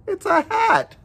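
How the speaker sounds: plain reading voice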